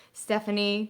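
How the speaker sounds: usual speaking voice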